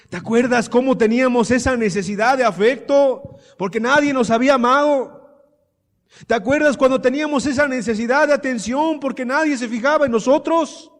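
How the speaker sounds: loud, high, impassioned appeal to remember; full-force preaching. Real, not acted. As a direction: preach it as a climbing series of cries.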